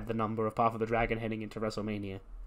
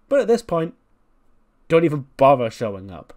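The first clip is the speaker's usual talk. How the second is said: furious diva rant; 2 semitones higher, 7 dB louder than usual. Retold or imitated. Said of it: "She goes".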